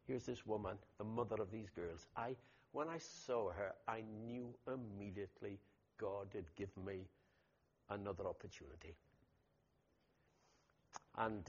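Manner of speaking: ordinary delivery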